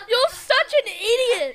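high, loud, drawn out through laughter; laughing exasperation. Real, not acted. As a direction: laugh out the insult, slowly. Teasing friend after the prank.

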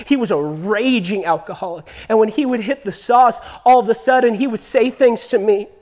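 normal talking voice

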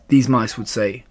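ordinary delivery, nothing marked

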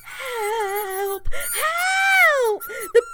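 very high, loud squealing shout; panicked cry for help. A narrator's reading. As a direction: scream for help in terror. Mice scurry back in panic.

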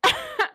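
high witch cackle. Retold or imitated. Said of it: "it sounded more like a witch cackle".

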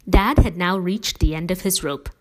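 usual speaking voice

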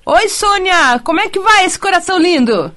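bright, quick, cheery hello to a caller; excited greeting. Real, not acted. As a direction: greet her with a lift. Apresentadora saúda ouvinte. (saudação animada)

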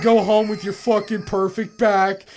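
high, loud, hammed-up envy; envying a friend's perfect back, mock rant; mock-bitter rant. Real, not acted. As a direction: exaggerate the jealousy for a laugh.

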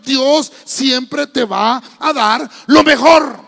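very loud, slow, word-by-word proclamation; rallying preacher shout. Real, not acted. Pastor feeding the congregation a line to repeat, rallying shout. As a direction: shout it slowly for the crowd to repeat.

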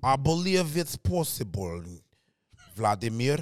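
low, stiff Russian accent. Shown as a demonstration. Comedian trying an accent he hasn't mastered, tentative Russian accent. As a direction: try a deep Russian accent. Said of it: "L'accent russe".

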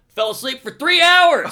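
very high, booming outburst; incredulous shout. Retold or imitated. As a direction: holler the disaster for comic shock. Comic outburst at oversleeping.

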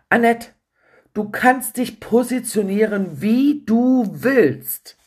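heated phone voice; agitated coach. Retold or imitated. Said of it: "Hörbar aufgebracht". (hörbar aufgebracht, gespielt)

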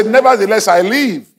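high preacher's call to repeat; rousing declaration. Real, not acted. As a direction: shout it high for the congregation to echo. Leads congregation in a declaration.

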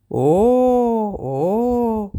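drawn-out sarcastic "اوه، اوه" rising high; mocking oh-oh. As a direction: stretch the oh slowly, mocking the couple. Rival catches them embracing. («اوه، اوه» کشیده و تمسخرآمیز)

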